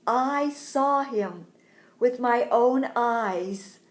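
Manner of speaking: loud, slow and insistent; emphatic testimony. Acted. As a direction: proclaim it slowly, stretching each word. Mary tells the doubting mourners.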